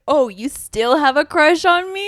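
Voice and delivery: mocking. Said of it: "laugh at him".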